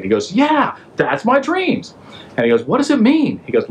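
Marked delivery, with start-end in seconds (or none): 0.3-1.9 very high, drawn-out mock excitement; amazed recognition. Pharaoh recognises his own dream. 2.6-3.4 very high-pitched, hammed-up eager question; excited demand. Pharaoh begs for the meaning.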